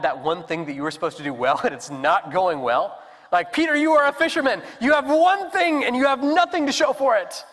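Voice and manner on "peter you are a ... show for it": higher, louder scolding taunt; mocking inner voice. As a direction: jab at him like a harsh inner critic.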